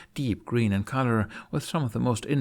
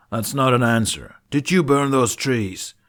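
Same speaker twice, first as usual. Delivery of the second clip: stern demand; 3 semitones higher, 7 dB louder than usual. A narrator's reading. Knight interrogating Jack.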